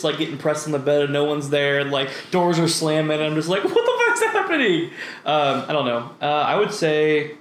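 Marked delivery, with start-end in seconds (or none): 3.6-4.9 very high freaked-out cry; mock panic. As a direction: squeal it like a comic horror victim. Imagined panic at slamming doors.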